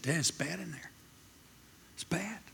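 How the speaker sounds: hushed, near-tears whisper of bad news; a son, barely holding back tears; almost weeping. Son telling his dad the news, almost weeping. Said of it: "almost weeping".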